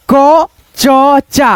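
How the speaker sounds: क च चा very slowly, each letter separate with its own vowel; drawn-out, unjoined क च चा; letter-by-letter misreading. Shown as a demonstration. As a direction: break it into three sounds, क, च, चा, with long gaps. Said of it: "ऐसे पढ़ेंगे क च चा".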